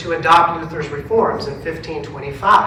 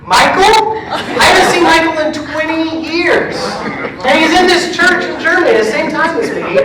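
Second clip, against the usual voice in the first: much higher, loud, laughing amazement; overjoyed astonishment; amazed disbelief; 11 semitones higher, 6 dB louder than usual. Retold or imitated. Pastor hearing his old classmate conducts, amazed and laughing.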